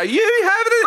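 high squealed cheer; high excited cheer at a friend; friends egging on. Retold or imitated. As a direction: egg your friend on in a squeal. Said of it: "your friends would go".

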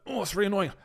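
exaggerated, put-on exasperation; self-mocking annoyance. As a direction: take the piss out of your own irritation. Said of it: "take the piss out of myself".

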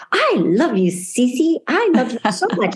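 a hint of a small child cooing at the mirror; mother imitating her little girl's mirror talk; quoting her little daughter. Six-year-old daughter voiced.

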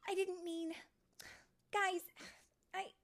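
high, halting, broken-off apology; flustered regret. Acted. Tink backtracking to hurt friends, flustered. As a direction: falter with pauses as you try to apologise.

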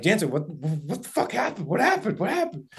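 imitating buddies demanding answers, pitch rising; friends asking excitedly. Hunting buddies pressing Jansen after the shot, rising excitement.

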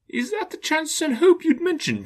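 high, quavering frightened plea; faltering voice. Acted. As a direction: ask it, voice faltering with dread. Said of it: "in a faltering voice".

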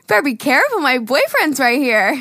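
put-on woman teasingly warning an older man; playful warning. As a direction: warn him off playfully. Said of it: "And I go".

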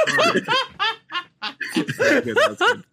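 high laughing; laughing at the friends' prank. Real, not acted. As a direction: gasp it out through laughter.

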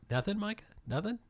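high, teasing nudge for a laugh; mock expectant prod. Real, not acted. Host teasing the silent producer, mock expectant. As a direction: coax a response playfully.

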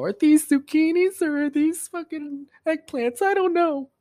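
high, mock-flustered girly voice; mocking ditzy girl voice. Mocking the acai shop girl, high flustered voice. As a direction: mimic a frazzled girl who can't tell vegetables apart.

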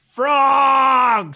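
high loud shout; yelling. Retold or imitated. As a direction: yell it across the theater. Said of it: "did he yell".

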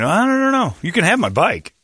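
put-on high timid kid voice; scared kid. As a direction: squeak it out like a bullied kid. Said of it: "like the giant bullies".

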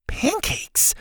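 very high, baffled echo of "Pancakes"; squeaked-up disbelief; incredulous. Acted. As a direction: repeat "Pancakes" as a disbelieving question. Listener questions the odd detail.

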